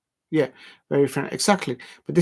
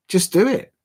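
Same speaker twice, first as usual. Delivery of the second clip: emphatic urging; 7 semitones higher, 5 dB louder than usual. Real, not acted. Urging viewer to try accents.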